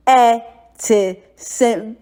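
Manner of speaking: "Ete seng" slow, short vowels, pitch falling; short vowel going down. Shown as a demonstration. Teacher models the short falling Twi vowels. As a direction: let "Ete seng" go down, never long. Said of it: "Let's do it again".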